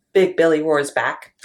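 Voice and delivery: ordinary delivery, nothing marked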